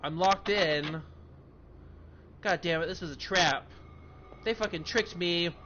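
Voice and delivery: loud furious ranting at the game; angry rant. Real, not acted. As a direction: blow up at being tricked. Realises the game trapped him.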